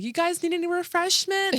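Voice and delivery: high, chirpy mock mom, oblivious; clueless sweet mom. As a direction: play the cheerful mom who notices nothing. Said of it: "she's like".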